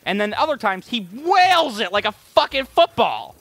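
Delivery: ordinary talk, nothing marked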